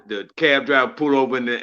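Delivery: ordinary talk, nothing marked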